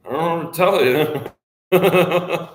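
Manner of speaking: high, slow, giggling reply; laughing through it. Retold or imitated. Telling the lady about her dog, high and laughing. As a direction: crack up while shrugging it off. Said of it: "And I'm like".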